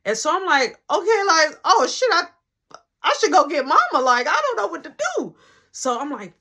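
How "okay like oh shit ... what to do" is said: high, loud, flustered reaction; mock panic, pitched up; retold panic. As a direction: act out being at a loss. Retold panic at sick guest.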